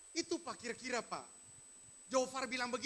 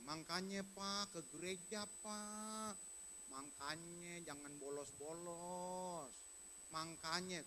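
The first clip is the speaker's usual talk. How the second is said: softer, slow reproachful nag; mock nagging visitor; 6 semitones lower, 12 dB quieter, slower than usual. Preacher imitating a church visitor nagging a sick man, soft and slow. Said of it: "Hati-hati dengan kata begini".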